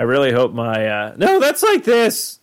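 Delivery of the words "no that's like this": put-on high cracking teen voice; put-on pubescent squeak; Squeaky Voice Teen. Shown as a demonstration. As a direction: squeak it out slowly, far above your own pitch. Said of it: "Squeaky voice teen".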